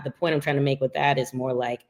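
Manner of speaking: ordinary talk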